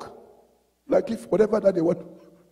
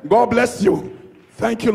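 ordinary delivery

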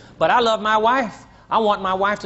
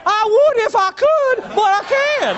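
high, pleading excuse voice; husband's whiny excuse; 12 semitones higher, 4 dB louder, slower than usual. Retold or imitated. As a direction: whine it like a helpless husband. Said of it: "And he telling her".

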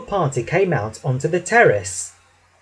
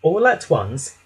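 ordinary delivery

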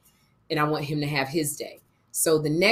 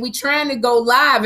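retold insistence; 6 semitones higher, 6 dB louder than usual. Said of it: "Kim kept saying".

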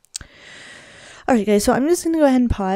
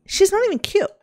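indignant. Real, not acted. Player jealous of Kelly with her man Tyler, indignant.

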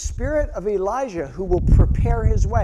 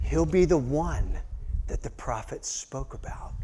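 lower, softer whisper; whispered angel message; 6 semitones lower, 6 dB quieter than usual. Acted. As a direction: whisper the promise like a secret.

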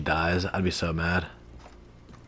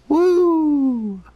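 excited cheer; 13 semitones higher, 5 dB louder than usual. Real, not acted. Celebrating a win in game.

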